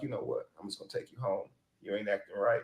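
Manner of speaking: hushed, gentle mock breakup voice; real calm. Man showing friends how he'd calmly send his date home, soft and calm. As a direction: say it softly, imitating an unbothered man. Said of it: "just gonna get real calm".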